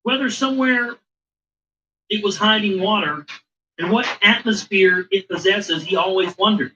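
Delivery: grave, even reading voice; story reading. Acted. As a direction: recite it like an old chronicle. Back to reading the text.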